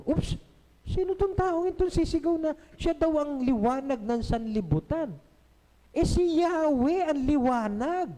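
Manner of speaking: high, indignant disbelief; who does he think he is; a listener shocked by Jesus' claim, high and outraged; scandalized. Acted.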